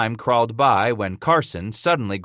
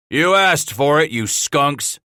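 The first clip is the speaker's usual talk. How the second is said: raised, hurt cry; cried as if wounded; 6 semitones higher, 5 dB louder, slower than usual. A narrator's reading. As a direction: yell it as if stung. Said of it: "the thief cried as if he had been wounded".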